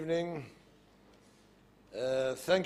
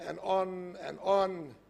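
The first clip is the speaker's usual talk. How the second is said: slowed right down, dragging each on; a mock-weary endless drag; drawn out; slower than usual. Shown as a demonstration. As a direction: stretch it out like it never ends. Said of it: "It just didn't stop".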